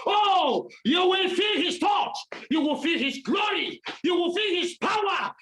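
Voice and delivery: high, ringing preacher's cry; fiery preaching. Acted. Preacher promising the congregation they will feel God. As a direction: build the promise line by line.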